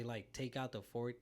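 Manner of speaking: ordinary talk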